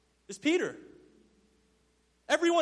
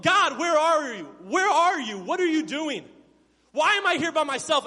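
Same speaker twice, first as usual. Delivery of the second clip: loud, raised-pitch desperate questioning; pleading cry to God; 5 semitones higher, 6 dB louder than usual. Acted. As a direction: demand answers from God, voice lifted. Anguished questioning of God in storm.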